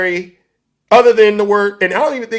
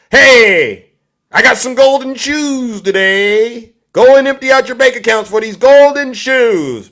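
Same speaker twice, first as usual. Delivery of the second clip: lightly voiced huckster, louder and drawn out; mock sales pitch; 4 semitones higher, 5 dB louder than usual. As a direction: hawk it loudly to the crowd. Mock Trump hawking golden shoes.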